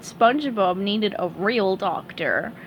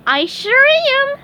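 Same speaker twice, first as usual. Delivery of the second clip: very high squeaky cartoon sponge; chirpy high-pitched sponge, cheerful; SpongeBob voice; 7 dB louder than usual. SpongeBob praising Doctor Patrick, high squeaky sponge voice.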